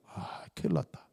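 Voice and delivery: soft, dismayed muttering; hushed, fretting to yourself; worried self-talk. Acted. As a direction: play someone realizing they are in trouble. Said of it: "고민이 많이 돼요".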